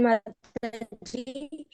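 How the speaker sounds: normal talking voice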